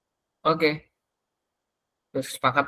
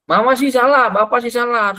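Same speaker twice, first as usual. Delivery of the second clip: higher, louder whiny blame; a child blaming both parents, higher and louder; 6 semitones higher, 5 dB louder than usual. Retold or imitated. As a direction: point the finger at mum and dad.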